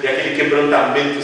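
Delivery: usual speaking voice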